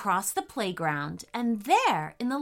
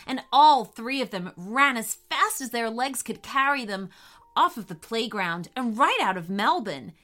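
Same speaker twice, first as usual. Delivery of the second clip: plain reading voice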